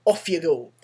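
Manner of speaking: lightly voiced hearty commander. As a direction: approve the soldier's plan and send him off. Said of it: "And the commander says".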